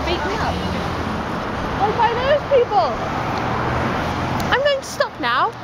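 high mock-British lilt; hammed-up British, pitched high; put-on British accent. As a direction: keep up the fake British voice. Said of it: "I'm going to vlog in a British".

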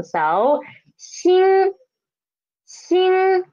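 "xin" held high and level, first tone; teacher's high level first tone. Shown as a demonstration. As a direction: sing "xin" high and flat. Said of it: "the tone is the first tone".

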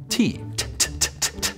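T tapped as a quick unvoiced t; T sound demo. Shown as a demonstration. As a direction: tap out T with tongue tip. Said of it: "tongue tapping on the roof".

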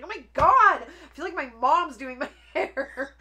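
loud, high retold outburst; hammed-up exasperated cry; mock exasperation. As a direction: wail it in mock outrage, breaking into laughter.

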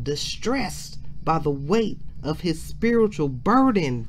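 loud, rising preacher's build; full-force preaching. Acted. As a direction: drive the sermon home with mounting force.

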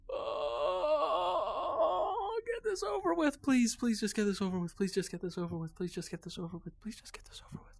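high wail sinking into a whisper; desperate whimpered chant, falling and fading; whiny pleading. Real, not acted. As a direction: beg on repeat, fading away to nothing. Begging for game to end.

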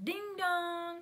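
sung doorbell chime, high; doorbell imitation. Telling a friend the doorbell rang, chiming doorbell imitation. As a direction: mimic the doorbell, two high notes.

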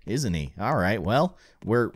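ordinary talk, nothing marked

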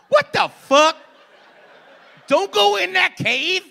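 high, loud, slow shout at the screen; mock moviegoer yell. Imitating a moviegoer shouting at the screen, loud.

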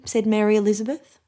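ordinary delivery

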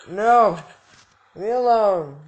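mock panicked shout. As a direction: yell to fend off. Fending off attacker.

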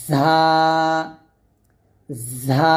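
ঝা with a strong buzzing z, then a long aa; firm, sustained repeat-after-me ঝা; firm voiced Za. Shown as a demonstration. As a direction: press out the z of ঝা with voice, then hold it. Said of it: "একটু শক্ত করে বা আওয়াজ বের করে".

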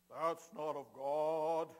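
loud, slow, drawn-out condemnation; disapproving Pharisee. Acted. Pharisees pointing a finger at laughter, loud slow disapproval. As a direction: pronounce it heavily, like a pompous religious accuser.

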